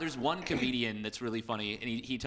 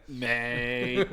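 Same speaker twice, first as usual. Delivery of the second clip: mock Aussie mate; 2 semitones lower than usual. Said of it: "every impression is just him saying that".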